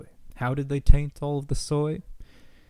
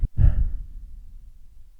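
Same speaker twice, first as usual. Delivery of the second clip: long sigh; 3 dB louder than usual. Real, not acted. Resigned end of the rant.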